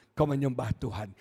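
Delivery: ordinary talk, nothing marked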